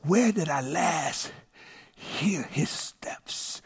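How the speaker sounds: slow, fading into a whisper; hushed wondering. Retold or imitated. Father voicing daughter seeking her brother, hushed. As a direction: think it aloud slowly, hushing at the end. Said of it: "the first thing she did was she remembered".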